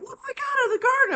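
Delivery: high, squealing excitement over a garden cucumber; excited gushing. Retold or imitated. Showing her daughter the garden cucumber, squealing with excitement. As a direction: gush like it's a prize.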